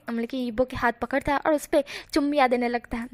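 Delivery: plain reading voice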